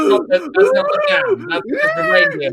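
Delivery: very high squeaky voice. Acted. Santa remarks on a noise.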